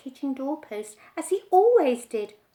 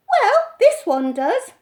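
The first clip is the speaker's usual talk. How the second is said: put-on high, much louder boy; boy insisting to his mother, high and loud; indignant child; 9 semitones higher, 12 dB louder than usual. A narrator's reading. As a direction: protest like a stubborn small boy.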